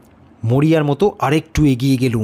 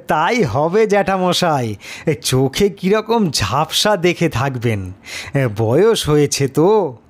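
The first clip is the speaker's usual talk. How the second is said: ordinary delivery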